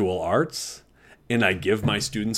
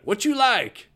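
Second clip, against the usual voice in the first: high, insistent Texas coach impression; imitating a coach's Texas drawl; 11 semitones higher than usual. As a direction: push it up high with a Texas twang. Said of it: "He had that Texas drawl".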